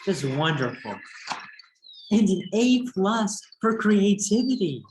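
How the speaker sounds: a joyful storybook reveal; excited. Acted. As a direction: proclaim it with storybook glee. Reading the book's triumphant line.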